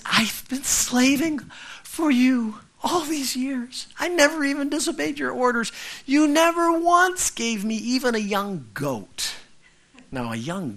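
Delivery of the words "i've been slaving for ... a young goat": put-on a hurt older son pleading his case; older son's complaint. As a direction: whine it out like the resentful son. Older brother's complaint voiced.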